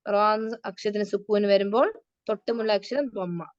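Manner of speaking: ordinary talk, nothing marked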